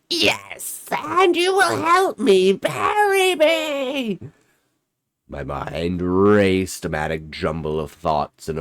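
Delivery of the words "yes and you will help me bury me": high, slow shrieking ghost demand; guttural rasp. Acted. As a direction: screech the order like a grave creature. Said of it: "her voice had returned to that guttural rasp".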